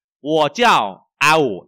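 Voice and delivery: loud, slow Chinese model sentence; model reading. Shown as a demonstration. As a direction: project each syllable slowly for the class. (đọc mẫu to, chậm, rõ từng tiếng)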